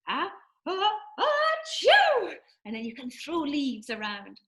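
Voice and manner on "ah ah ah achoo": high, building fake sneeze; pretend sneeze. Shown as a demonstration. Somebody sneezed in the leaves song, pretend sneeze. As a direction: build in gasps to a loud achoo. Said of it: "somebody sneezed".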